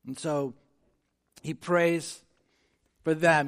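ordinary talk

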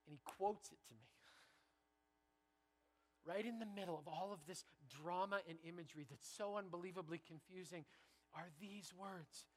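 ordinary talk, nothing marked

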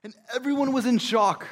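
normal talking voice

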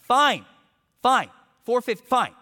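loud, high bursts of annoyance; mock snapping as you give in; punchy, fed-up retort, acted out; imitated exasperated surrender. Seller gives in angrily.

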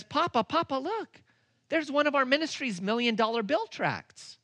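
put-on high excited child's voice, pointing something out; a son tugging at his papa; young son calling out. Son in the airport calling his papa, high and eager. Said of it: "my son Luke points ... and he says".

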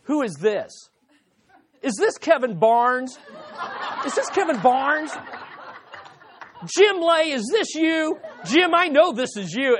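laughing at a supposed prank caller, pitch climbing; wife sure it's a prank call, laughing and rising; laughing accusation. Retold or imitated.

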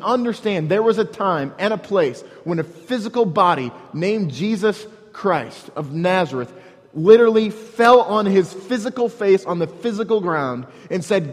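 ordinary talk

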